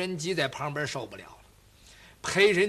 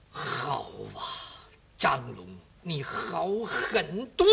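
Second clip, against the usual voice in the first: bitter low fury; 7 semitones lower, 6 dB quieter, slower than usual. Acted. 咬牙切齿恨元帅.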